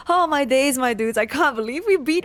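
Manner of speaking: high, loud victory yell; overjoyed outburst. Real, not acted. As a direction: shout it out like you just won. Celebrating beating the boss.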